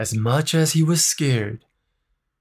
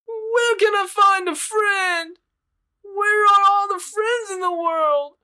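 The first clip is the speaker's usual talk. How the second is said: wistful little bird. Acted. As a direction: wonder longingly aloud. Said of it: "he thought to himself".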